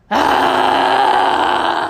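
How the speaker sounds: hammed-up toilet roar; imitated loud toilet noise. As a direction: yell it like a roaring flush. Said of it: "It's like".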